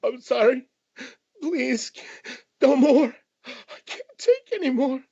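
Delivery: very high, whimpering plea; crying man. Acted. Broken stranger begs his rescuer, whimpering. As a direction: beg in a squeezed-up crying voice. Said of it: "That's a crying man".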